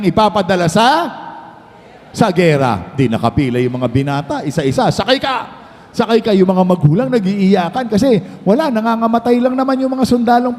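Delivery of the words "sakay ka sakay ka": high-pitched mock boarding order; mock command. General sending young men off to war, mock command. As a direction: call out the order in a raised pitch.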